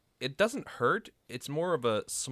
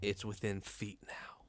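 slow near-whisper; hushed and drawn out, as the creature closes in; suspenseful scene setting; 2 semitones lower, 10 dB quieter, slower than usual. Acted. Game master builds suspense.

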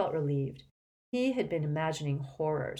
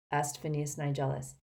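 ordinary delivery, nothing marked

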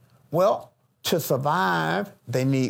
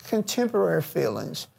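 usual speaking voice

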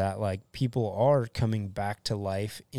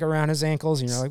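ordinary talk, nothing marked

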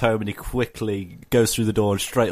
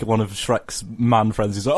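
ordinary delivery

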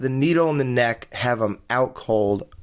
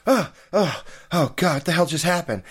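groaning, higher, then hurried; dazed normal person; 3 semitones higher, faster than usual. Acted. Normal person sitting up after being attacked, mock bewilderment. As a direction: come to with groans, then blurt it quickly. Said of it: "any normal person would be like".

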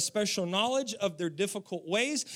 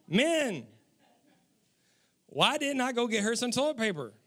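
ordinary talk

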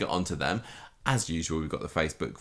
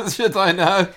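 laughing; 11 semitones higher, 6 dB louder, slower than usual. Real, not acted. Laughs at not knowing.

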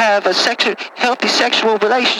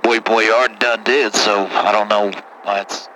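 put-on much lower, rough-talking good ol' boy; deep caller voice; 12 semitones lower than usual. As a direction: play a low-voiced country caller. Same caller voice.

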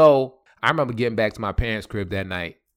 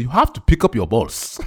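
West African father; 4 dB louder than usual. Retold or imitated. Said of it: "alpha male West African".

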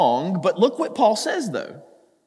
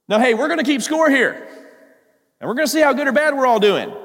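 higher-pitched imitation of pushy scorekeepers; mock-insistent announcement; 6 semitones higher, 4 dB louder, faster than usual. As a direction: lay down the rules, playfully overdone. Voicing the rule-keeping teachers' demand.

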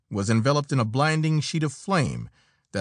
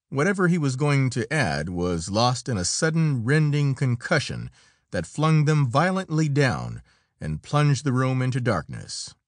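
ordinary delivery

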